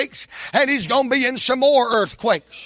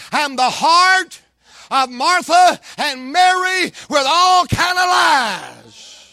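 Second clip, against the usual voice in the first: slow, higher, louder preaching; building sermon; 4 semitones higher, 4 dB louder, slower than usual. Acted. Preacher builds on the devil's four days of lies. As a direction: stretch each phrase and build.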